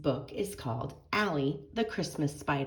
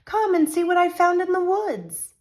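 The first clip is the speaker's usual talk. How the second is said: high, loud call across the house; a father calling out excitedly as he comes in the front door; 11 dB louder than usual. Acted. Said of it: "Beth's father called".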